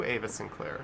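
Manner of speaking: ordinary talk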